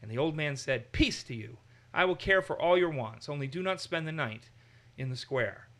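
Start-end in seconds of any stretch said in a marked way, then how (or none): none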